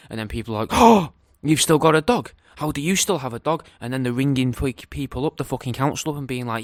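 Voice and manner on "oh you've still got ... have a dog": loud, high gasp of shock at the news; shocked disbelief. Pretend. Neighbours in the shop, scandalised, loud shocked disbelief. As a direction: act scandalised that anyone still has a dog.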